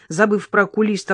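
ordinary delivery

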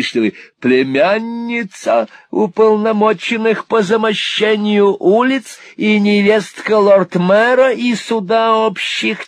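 put-on high-pitched, loud, agitated old man; eccentric old gentleman. A narrator's reading. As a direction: pile up titles in a high, insistent voice. Old gentleman voiced in story.

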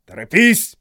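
loud urging order; shouting drill sergeant. A narrator's reading. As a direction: hurry them along loudly. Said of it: "присоединился к нему сержант Грин". (подгоняющий окрик)

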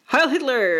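hammed-up TV Nazi salute; Nazi greeting. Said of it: "greet each other in German".

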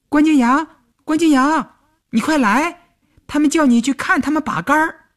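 put-on high-pitched girlish call through a window. A narrator's reading. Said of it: "朝窗户里喊". (尖着嗓子朝窗里喊)

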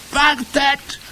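high-pitched witch cry; scream. A narrator's reading. Witch halts the others after sniffing the air, screaming. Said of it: "schrie sie wieder".

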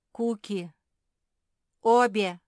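urgent imploring call; pleading. A narrator's reading. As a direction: beg both of them to stop. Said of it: "взмолился Филипп".

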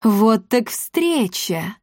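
slow, drawn-out villainess greeting; unhurried villainess voice; menacing milady. A narrator's reading. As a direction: greet them slowly with mock surprise. Villainess confronts the fleeing children.

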